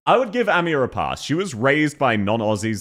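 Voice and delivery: usual speaking voice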